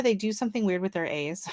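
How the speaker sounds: ordinary delivery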